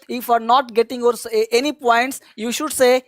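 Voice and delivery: ordinary delivery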